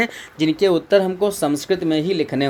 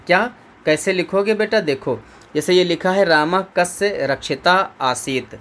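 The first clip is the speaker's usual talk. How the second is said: usual speaking voice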